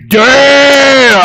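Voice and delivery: high, loud stunned damn; amazed exclamation. Retold or imitated. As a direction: blurt it out, caught off guard. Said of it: "I was like".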